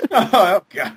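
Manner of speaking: ordinary delivery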